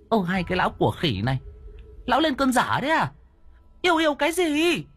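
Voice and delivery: a wife ticking off her foolish husband; high exasperated woman voice; indignant wife voice. Acted. Wife scolds the men.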